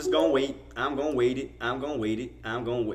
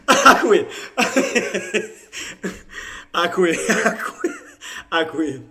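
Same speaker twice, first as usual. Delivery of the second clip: giggling through the words; can't stop laughing, gives up; real laughter breaking in; 6 semitones higher, slower than usual. Real, not acted.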